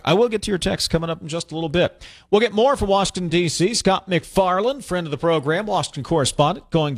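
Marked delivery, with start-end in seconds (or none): none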